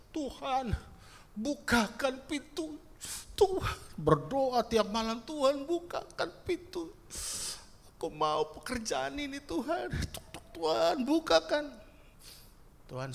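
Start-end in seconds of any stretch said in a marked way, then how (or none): 0.1-3.8 high, pleading knock-and-beg voice; whiny begging prayer. Acted. Praying believer nags God to open the door, high whiny plea. 5.2-11.6 high, pleading knock-and-beg voice; whiny begging prayer. Acted. Praying believer nags God to open the door, high whiny plea.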